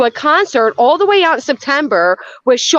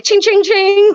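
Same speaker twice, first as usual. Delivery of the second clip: slot machine jingle; 9 semitones higher, 4 dB louder, much slower than usual. Retold or imitated. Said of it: "it's like".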